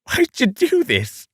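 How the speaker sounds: high, melodramatic Australian plea; overacted Australian, pitch way up; Australian accent. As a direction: wail it in a put-on Aussie accent. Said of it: "using the accent again".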